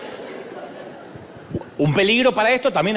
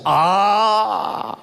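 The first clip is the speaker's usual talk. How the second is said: ordinary delivery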